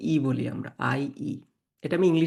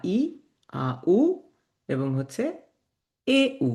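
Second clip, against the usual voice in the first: ordinary delivery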